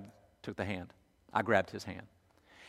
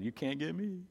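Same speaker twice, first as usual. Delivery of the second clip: soft, higher, teasing sing-song; taunting little boy; 4 semitones higher, 8 dB quieter than usual. Retold or imitated. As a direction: tease the dogs from safety. Boy taunting dogs from dad's arms.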